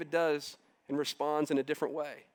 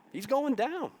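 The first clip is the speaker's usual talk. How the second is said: rising preacher's cry; 9 semitones higher than usual. Real, not acted. Preacher's climax about the giant.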